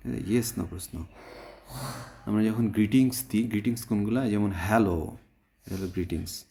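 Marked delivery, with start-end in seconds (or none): none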